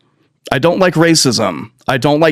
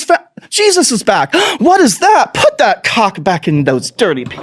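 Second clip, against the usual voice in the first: high, frantic mock panic of a caught politician; panicked hypocrite; 7 semitones higher than usual. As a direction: squeal it out in hammed-up panic.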